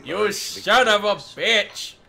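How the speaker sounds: loud, slow outraged yell. Real, not acted. Losing the game, yelling.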